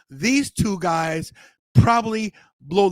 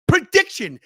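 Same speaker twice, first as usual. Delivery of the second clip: high, loud, falling at the end; loud announcement; 8 semitones higher, 4 dB louder than usual. Shown as a demonstration. Host launching the prediction segment, loud announcer delivery. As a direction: announce it big, like a segment title.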